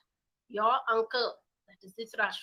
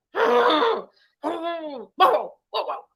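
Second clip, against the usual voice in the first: loud, high dog barks; barking dog; 7 semitones higher, 13 dB louder, much slower than usual. Acted. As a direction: bark like a fierce dog at the cats.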